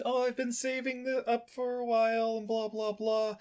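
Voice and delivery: high put-on mom chatter; mock mother telling her son about savings.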